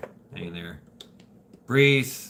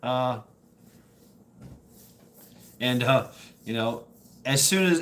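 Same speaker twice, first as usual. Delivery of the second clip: ordinary delivery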